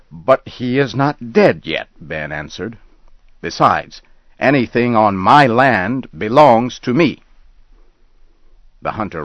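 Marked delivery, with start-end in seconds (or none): 0.1-1.9 higher, pushing back hard; indignant protest. Acted. Ben argues with the hunter. 3.4-7.2 higher and louder, asserting ownership; angry claim. Acted. Ben argues with the hunter.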